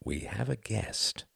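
soft, slow, careful telling; gentle. Acted. Quentin warns Ruth they have company, gently. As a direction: break it to her softly and slowly. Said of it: "said Quentin gently".

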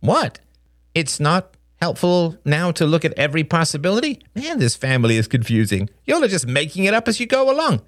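put-on high, cheeky boy's backtalk; mock-baffled kid protest; feigned innocence. A narrator's reading. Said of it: "Justin blinked innocently".

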